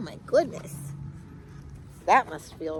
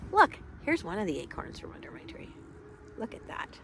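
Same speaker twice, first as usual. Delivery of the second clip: 1 hushed aside; 7 dB quieter, faster than usual. Real, not acted. Showing an acorn, hushed.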